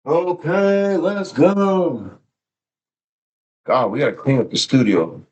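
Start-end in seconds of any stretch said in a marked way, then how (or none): 0.1-2.2 very high drawn-out hype shout; hype call. Real, not acted. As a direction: belt it like a hype man. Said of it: "I'll do it myself".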